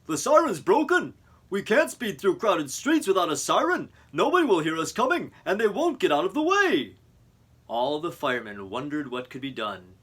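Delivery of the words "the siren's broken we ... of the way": high, loud urgent alarm; worried. Acted. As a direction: blurt it out in a worried rush. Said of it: "in a worried voice".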